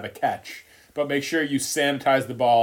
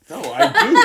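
laughing; 9 semitones higher, 7 dB louder, much faster than usual. Real, not acted. Laughs at joke.

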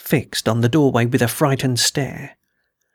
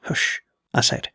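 soft, short shushing; hushed warning; 4 dB quieter than usual. A narrator's reading. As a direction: hush them so nobody wakes. Warning him not to wake others.

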